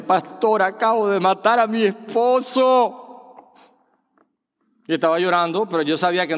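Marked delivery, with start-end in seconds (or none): 0.0-3.5 pitched up, slow, mock tearful plea; imitating a tearful woman. A woman crying to her pastor, imitated tearfully. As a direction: voice a distraught woman, lightly weepy. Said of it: "llorando, me dice".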